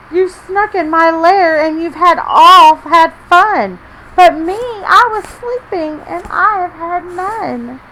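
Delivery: high, loud, slow sulking bear; grumpy bear crying out to his guests; whiny upset bear. Acted. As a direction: wail it like a hurt big bear. Bear complains, whimpers.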